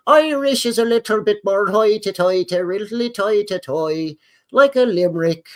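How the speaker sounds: lilting limerick-style mock Irish. Shown as a demonstration. Streamer jokes about Irish accents, sing-song mock Irish. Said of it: "B minus level impressions".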